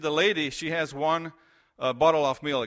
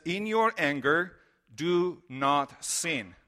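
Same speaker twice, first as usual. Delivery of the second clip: slow, solemn Bible reading; scripture reading; slower than usual. Real, not acted. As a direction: give each phrase weight, slowly. Reads Bible verse slowly.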